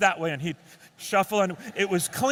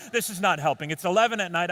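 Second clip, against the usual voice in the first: normal talking voice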